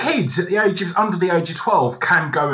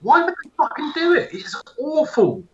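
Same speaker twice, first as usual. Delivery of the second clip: incredulous outburst; 11 semitones higher, 4 dB louder than usual. Real, not acted. Joining the rant against the movie, high incredulous outburst.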